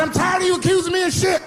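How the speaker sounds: high, shrieking defensive shout; outraged husband. Retold or imitated. Husband yelling at wife before fleeing, high defensive shout. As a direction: screech it and storm out.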